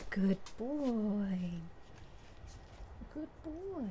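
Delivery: sweet praise for the pup; praising the dog. Real, not acted. Owner praising her dog, high soft coo.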